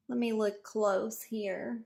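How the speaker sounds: normal talking voice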